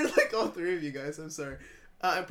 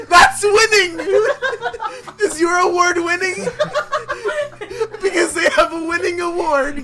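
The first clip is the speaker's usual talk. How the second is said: high, loud laughing voice; laughing hard; 11 semitones higher, 12 dB louder than usual. Real, not acted. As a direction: laugh through the words. Laughing fit mid ad.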